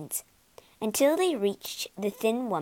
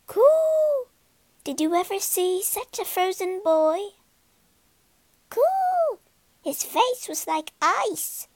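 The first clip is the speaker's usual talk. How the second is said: very high, fluttery bird voice; cooing pigeon; 10 semitones higher than usual. Acted. Pigeons gossip to Gerda in a very high coo. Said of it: "The pigeons began talking".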